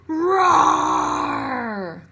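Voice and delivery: big scary roar, full voice; loud lion roar. Shown as a demonstration. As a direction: roar like a lion to scare the kids. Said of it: "it sounds like this".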